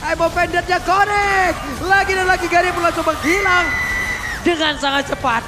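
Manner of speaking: loud, high hype shouting; esports caster. Real, not acted. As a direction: yell the play-by-play with full energy. Caster calls the fight.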